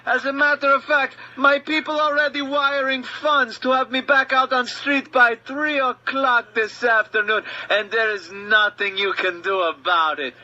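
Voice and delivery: movie villain sneering at the cop he knows can't shoot; foreign bad guy speech. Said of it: "the bad guy starts his speech".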